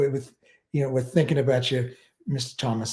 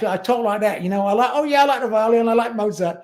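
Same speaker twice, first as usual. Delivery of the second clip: mocking working-class lad, higher and louder; exaggerated working-class Brit, pitched up and loud; working-class English accent; 7 semitones higher, 3 dB louder than usual. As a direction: ham up a blokey English voice, higher. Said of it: "became very working class".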